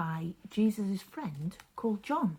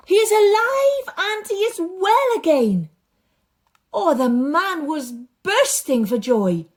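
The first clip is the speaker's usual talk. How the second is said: lively, expressive storytelling voice; story narration for children; 8 dB louder than usual. A narrator's reading. Storyteller reading a Bible story aloud to children, animated. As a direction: paint the story big for the kids.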